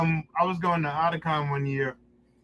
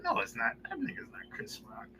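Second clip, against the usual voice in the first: higher, softer, almost whispered; hushed denial; 5 semitones higher, 12 dB quieter than usual. Retold or imitated. To his friend in the elevator, hushed and high. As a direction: scoff it under your breath.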